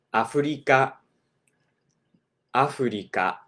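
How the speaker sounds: Afrika with tapped r, a-fu-ri-ka; アフリカ; Japanese Afrika. Shown as a demonstration. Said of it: "If you want to say".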